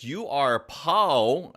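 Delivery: ordinary talk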